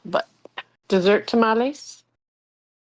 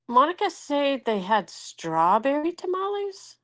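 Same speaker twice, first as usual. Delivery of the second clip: ordinary delivery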